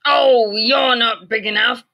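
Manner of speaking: loud high whiny scoff; grouchy ladybug voice. A narrator's reading. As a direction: scoff it with a whine. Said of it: "said the grouchy ladybug".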